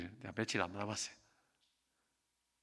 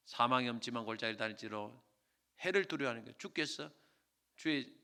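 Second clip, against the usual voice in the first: measured, reverent psalm reading; scripture recitation; 5 dB louder than usual. Acted. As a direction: pastor quoting Psalm 23 to comfort the flock.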